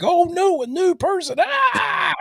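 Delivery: very high mock scream of alarm; panicked inner voice. Inner self meeting someone new, high mock panic. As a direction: shriek it in comic dread. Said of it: "Energon is like".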